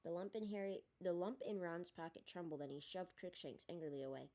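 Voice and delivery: ordinary delivery, nothing marked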